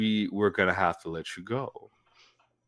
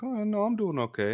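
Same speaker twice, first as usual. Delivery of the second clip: higher, chipper self; 12 semitones higher than usual. Retold or imitated. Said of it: "I'm like".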